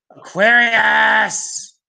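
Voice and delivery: high, drawn-out cry of mock horror; mock dismay. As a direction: wail the word slowly and loudly. Mock horror at learning Aquarius.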